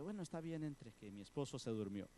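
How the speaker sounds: whisper-soft so he stays asleep; woman quietly lets the sister in; hushed invitation. Acted. Husband asleep, speaking softly.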